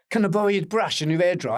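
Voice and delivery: high, loud, polite request; Bowie asking a favour. Retold or imitated. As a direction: ask sweetly for the brush. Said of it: "And he goes".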